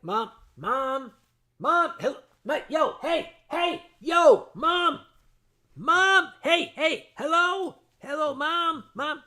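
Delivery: loud, high repeated calls over a party; trying to reach mom through party din; yelling for mom. Retold or imitated. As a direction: shout to be heard over the noise.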